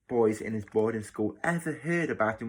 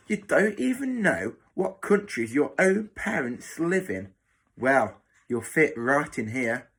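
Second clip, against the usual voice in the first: scornful matron; 3 semitones higher than usual. Acted. Matron mocking children whose parents never visit, scornful. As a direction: belittle the children one after another.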